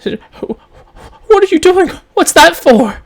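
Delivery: high, loud startled cry; stammer, then blurt it out in shock; startled high-pitched questions; surprised. Acted. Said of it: "she'll be surprised".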